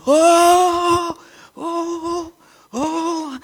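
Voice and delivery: high, loud, amazed "Åh" cries; priest's ecstatic high-pitched "åh"; excited cry. Retold or imitated. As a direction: cry out in delighted surprise, very high. Priest spots him, cries out.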